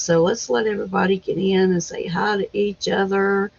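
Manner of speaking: ordinary talk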